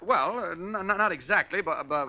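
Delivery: stammering, tripping over words; flustered stutter, caught out; nervous stutter. Acted. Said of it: "Clark, what are you stuttering about?".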